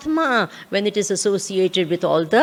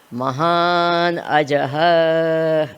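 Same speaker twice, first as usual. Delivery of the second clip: slow and low, each syllable weighted; slow emphatic answer; 6 semitones lower, much slower than usual. Real, not acted. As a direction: lower and drawn out. Teacher answers own question slowly.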